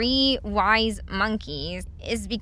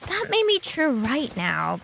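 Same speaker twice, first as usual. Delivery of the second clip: ordinary talk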